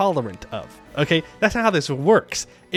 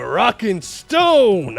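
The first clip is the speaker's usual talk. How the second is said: rallying cry; 4 semitones higher, 5 dB louder, much slower than usual. Pretend.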